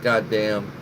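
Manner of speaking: usual speaking voice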